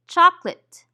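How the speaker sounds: "chocolate" cut to CHOK-lit; dropped schwa syllable. Shown as a demonstration. Teacher modelling for learners to repeat, schwa dropped. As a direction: say "chocolate" without its middle syllable. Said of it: "we usually eliminate the syllable".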